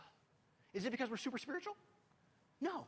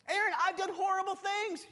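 high, louder plea of a guilt-ridden believer; pleading doubter; 11 semitones higher, 7 dB louder than usual. Pretend. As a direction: voice the doubter begging to be told no. Imagined listener objecting to preacher.

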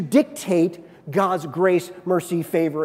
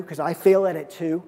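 usual speaking voice

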